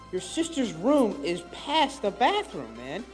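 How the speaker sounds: ordinary talk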